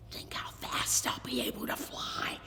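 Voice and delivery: hushed, dreamy whisper; awed whisper. A narrator's reading. Boy dreaming of speed, whispering in awe. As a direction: breathe it like a secret. Boy's secret daydream.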